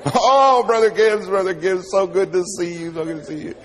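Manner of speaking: high, loud, gushing greeting; bubbly preacher. Retold or imitated. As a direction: play the overeager preacher, all bubbles. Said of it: "he comes up all bubbly".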